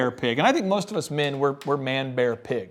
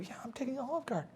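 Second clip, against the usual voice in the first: very soft, higher, quick answer; hushed reply; 7 semitones higher, 17 dB quieter, faster than usual. Retold or imitated. Man telling his date about dinner, hushed. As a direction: toss it off under your breath.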